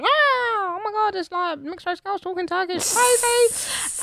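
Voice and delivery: very high, slow squeal of amazement; mock shock. Shop woman overhearing Turkish, squealing in shock. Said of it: "obviously shocked".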